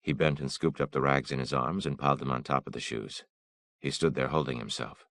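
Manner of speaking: ordinary delivery, nothing marked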